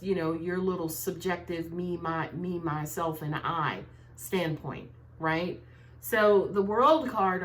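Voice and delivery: ordinary talk, nothing marked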